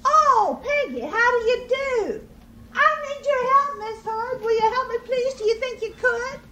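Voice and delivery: high, loud pig on the phone; urgent piggy plea. A narrator's reading.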